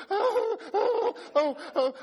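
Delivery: high, hammed-up panicked oh's with a laugh; mock panic. Pastor retelling his blood pressure scare, high mock panic. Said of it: "All of a sudden, I'm going".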